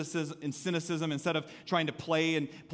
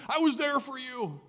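mock smug reproach; 8 semitones higher than usual. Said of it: "rub it in your face and say".

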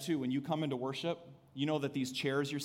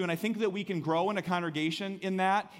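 normal talking voice

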